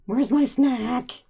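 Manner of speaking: high, loud impatient cat; whiny hungry cat voice. Retold or imitated. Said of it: "I was like".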